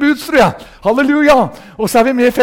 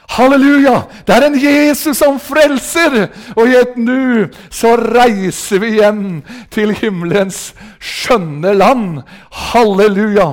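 loud, drawn-out revival proclamation; full-force hallelujah preaching; fervent preaching; 3 dB louder, slower than usual. Real, not acted. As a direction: proclaim it slowly and triumphantly to the hall.

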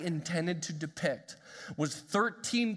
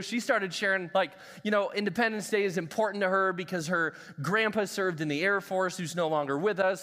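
ordinary talk, nothing marked

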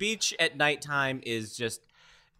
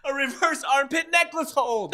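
excited fight commentator; 10 semitones higher, 5 dB louder than usual. Pretend.

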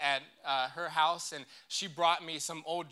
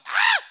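sudden high piercing scream; toddler scream; 13 dB louder than usual. Retold or imitated. Imitating little Luke screaming at eight in the morning. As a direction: imitate the toddler's shriek at full blast. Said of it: "very loud".

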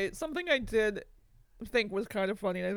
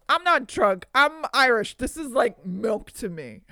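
put-on drunken protest; 2 semitones higher, 8 dB louder than usual. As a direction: bellow it like a tipsy guy denying it. Drunk Edward Norton loudly protesting.

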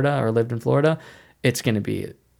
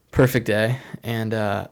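ordinary talk

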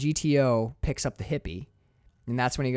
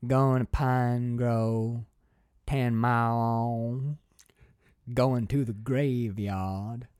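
put-on slow, lower old country woman with long pauses; old woman's voice; 4 semitones lower, much slower than usual. Old woman to the driver, slow and low-pitched. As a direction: drag each phrase out slowly and lower. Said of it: "I'll try and do her voice too".

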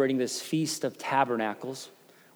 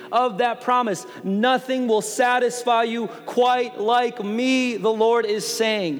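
higher, louder, driving sermon voice; preaching at full force; 5 semitones higher, 4 dB louder than usual. Acted. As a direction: preach it hard to the congregation.

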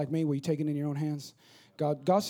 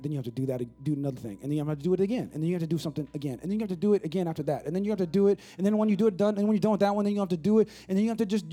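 relentless repetition; faster than usual. Shown as a demonstration. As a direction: roll on without a break, climbing in pitch and volume. Builds an endless list by repetition.

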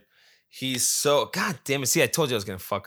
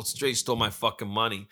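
ordinary delivery

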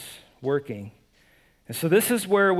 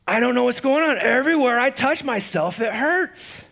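whiny patient; 7 semitones higher, 5 dB louder than usual. Retold or imitated. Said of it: "he walks in and he says".

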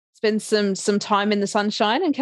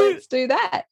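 high, gleeful decision; bright cheeky resolve; excited; 11 semitones higher than usual. Retold or imitated. Decides on pole dancing.

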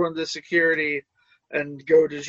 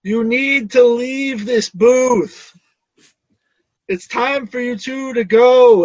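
slow, loud, firm order to leave; a fed-up bouncer clearing the booth, loud and slow; annoyed; 6 semitones higher, 7 dB louder, slower than usual. Acted. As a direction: lay it down slow and heavy, fed up. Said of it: "I more or less just sound annoyed".

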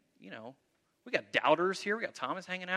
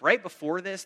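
ordinary delivery

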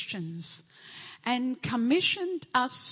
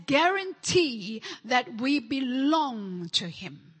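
preaching scripture; 3 semitones higher, 4 dB louder than usual. Real, not acted. Reading scripture with emphasis.

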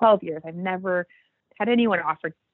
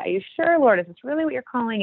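pleading question; 6 semitones higher than usual. Retold or imitated. Retold prayer questioning God.

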